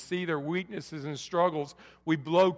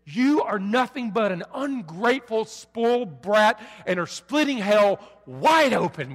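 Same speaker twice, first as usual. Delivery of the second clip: retold yelling mother; 5 semitones higher, 7 dB louder than usual. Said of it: "she yells at me and she says".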